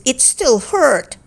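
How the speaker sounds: plain reading voice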